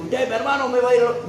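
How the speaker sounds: imitation of a pushy instruction; bossy mock command. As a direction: order a brother about, high and forceful. Acted order to a brother.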